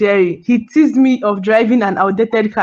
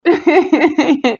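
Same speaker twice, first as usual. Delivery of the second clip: bright high burst of laughter; delighted laugh; 6 semitones higher than usual. Real, not acted. Mother laughing proudly about her husband's gift, high delighted laugh.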